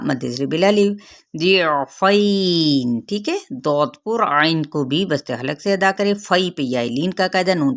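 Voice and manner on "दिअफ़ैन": drawn out, chanted recitation of the word; slow Quran recitation. Shown as a demonstration. Teacher reciting a Quran word for students, slow and chanted. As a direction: recite it slowly and melodically, stretching the vowels.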